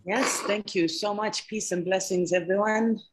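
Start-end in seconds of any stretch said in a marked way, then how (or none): none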